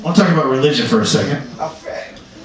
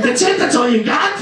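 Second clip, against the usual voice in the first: high-pitched disbelief; mock incredulous challenge; 13 semitones higher than usual. Rejected soul challenging Saint Peter's list, incredulous. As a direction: protest as if the charges are trivial.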